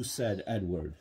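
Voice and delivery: usual speaking voice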